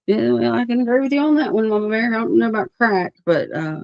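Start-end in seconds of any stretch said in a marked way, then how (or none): none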